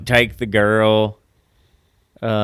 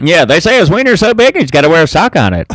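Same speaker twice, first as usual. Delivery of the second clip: put-on old radio announcer; 8 semitones higher, 10 dB louder, faster than usual. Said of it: "make me sound like an old radio announcer".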